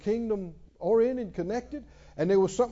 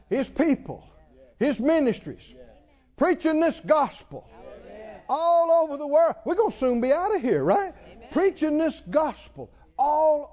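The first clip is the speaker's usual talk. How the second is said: full-force preaching; 5 semitones higher than usual. Acted. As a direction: preach it to the back row, each phrase punched.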